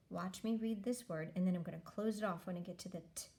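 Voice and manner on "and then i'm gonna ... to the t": normal talking voice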